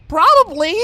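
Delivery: rising panic, barely holding on; panicked Bon Bon. A narrator's reading. Bon Bon scolding Lyra, frantic and high. Bon Bon's voiced lines.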